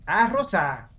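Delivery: loud, high cry of discovery; real excited "aha" shout; sudden realisation. Real, not acted. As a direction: burst out as the answer hits you. Sudden realisation of puzzle colour.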